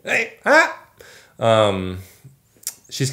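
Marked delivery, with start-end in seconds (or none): 0.0-0.8 very high-pitched imitation of a baby's shout; mock baby yell. Dad copies jealous baby's call, squeaky mock yell. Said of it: "yells at you and says".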